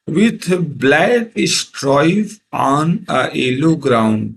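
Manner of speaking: very slow, each word drawn out; slow poem reading. Shown as a demonstration. Teacher reading a poem line to students, very slow. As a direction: read it word by word for the class. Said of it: "teacher reads poem line".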